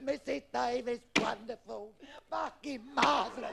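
high, hammed-up acclaim; high-pitched exultant praise; acted elation. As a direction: cry out praise to a pupil. Pro acclaims her huge shot.

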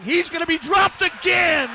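excited sack call. Real, not acted. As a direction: leap up in voice as he falls. Calling a sack.

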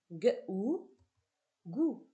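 hard "gue", then "ou", then blended into "Gou"; teacher blending the sounds into "Gou"; sounding out syllables. Shown as a demonstration. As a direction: say the two sounds apart, then join them as "Gou". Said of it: "on va passer à la combinatoire".

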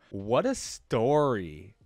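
loud, slow, drawn-out admiration; impressed exclamation. Real, not acted. Reader finishing Evelynn's tale, loudly impressed. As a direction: stretch it out, wowed. Admiring reaction after reading.

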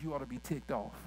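ordinary talk, nothing marked

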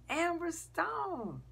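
high, drawn-out call of her name; mock yell. A narrator's reading. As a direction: holler it high and long, without much extra volume. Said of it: "and he yells".

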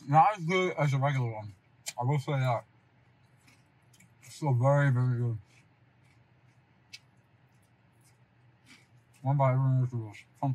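talking while chewing; eating pizza while talking; mouth full. Real, not acted. Seen 3: bites at -1, then speaks with pizza held at the lips.

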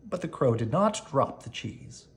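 ordinary delivery, nothing marked

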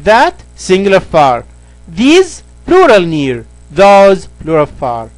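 slow, deliberate recap of the four words. Shown as a demonstration. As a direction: spell it out slowly for the class. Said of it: "مرة تانية نقول". (تلخيص بطيء ومتأنٍ)

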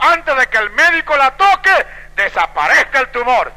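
loud, high proclamation; commanding decree. Retold or imitated. Prophet ordering a tumor to vanish, loud commanding decree. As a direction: raise the voice and order it gone.

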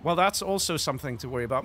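ordinary talk

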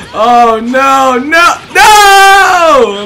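high, loud noes building to a wail; dismayed screaming. Real, not acted. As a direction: scream it, climbing as defeat lands. Losing the match.

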